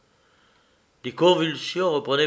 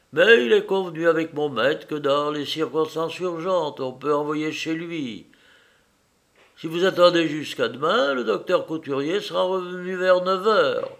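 higher-pitched servant explaining; troubled servant; 5 semitones higher, 3 dB louder than usual. Acted. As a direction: voice an uneasy maid, pitched up.

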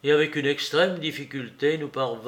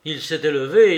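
ordinary delivery, nothing marked

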